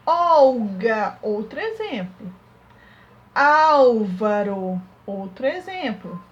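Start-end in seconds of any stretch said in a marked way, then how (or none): 0.1-1.2 "Olga" with a loud, stretched OU at the start; sounded-out "Olga", first syllable held long. Shown as a demonstration. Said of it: "vai ter som de U". 3.3-4.8 "Álvaro" with a loud, long AU; slow, sounded-out "Álvaro", stressed first syllable. Shown as a demonstration. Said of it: "vai ter som de U".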